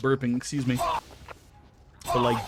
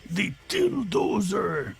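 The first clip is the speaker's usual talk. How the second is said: shouting; 10 semitones higher, much slower than usual. Acted. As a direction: bellow it like a battle cry. Cheering a favorite movie joke.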